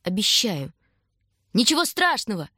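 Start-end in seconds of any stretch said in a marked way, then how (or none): 1.5-2.5 high call, trying to smile; raised, encouraging cry; shouted reassurance. A narrator's reading. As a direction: shout back bravely through a forced smile. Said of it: "крикнул он, пытаясь улыбнуться".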